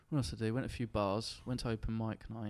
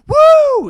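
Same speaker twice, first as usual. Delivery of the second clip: high, loud mock cheer; loud party whoop; 28 dB louder than usual. Describing loud Americans in bars, a high loud whoop. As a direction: whoop like a rowdy bar crowd. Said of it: "they're really loud".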